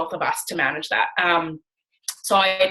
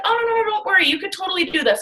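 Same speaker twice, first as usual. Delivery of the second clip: much higher, bubbly brush-off of her worries; eager reassuring friend; 12 semitones higher than usual. Retold or imitated. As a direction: wave away the doubts brightly and eagerly.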